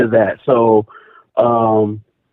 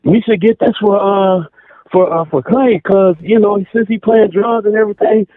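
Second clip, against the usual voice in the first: higher-pitched imitation of little sister; 8 semitones higher than usual. Little sister asking mom for the drum set, imitated higher. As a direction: lightly pitch it up as the pleading kid sister. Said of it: "and she was like".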